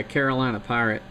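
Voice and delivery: usual speaking voice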